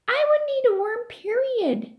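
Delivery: put-on high, firm kid refusal; stubborn boy. Joe refusing flat out to his friends, high stubborn kid voice. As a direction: dig in your heels like a kid.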